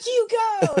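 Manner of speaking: mock cheering. Sketch guys cheer biker again, high cheer. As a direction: cheer him on gleefully.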